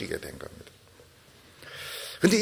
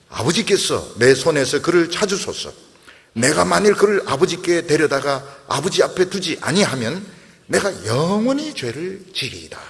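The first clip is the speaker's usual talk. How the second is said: louder, solemn reading of a Bible verse; scripture reading; 6 dB louder than usual. Acted. Preacher reading Judah's pledge to the congregation, solemn and louder.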